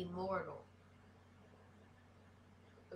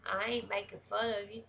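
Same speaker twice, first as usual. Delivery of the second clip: normal talking voice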